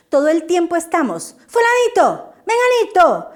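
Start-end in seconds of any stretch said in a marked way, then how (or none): 1.5-3.3 higher, louder calls of pupils' names; calling names too loud. Shown as a demonstration. Teacher calling pupils' names in an overloud, high voice. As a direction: call the children's names far too loud. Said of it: "un tono de voz demasiado alto y demasiado fuerte".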